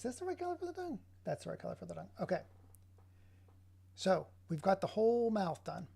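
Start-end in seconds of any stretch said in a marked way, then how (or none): 0.0-1.0 very high, soft, quick little voice; squeaky mock question. Checking the tongue colour to oneself, in a high put-on squeak. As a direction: jump way up high for a rapid, playful question.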